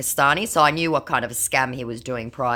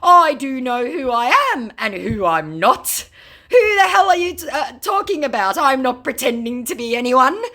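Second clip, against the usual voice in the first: mock scammer outrage; 11 semitones higher, 7 dB louder than usual. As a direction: shriek the fake outrage. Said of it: "my scammer said".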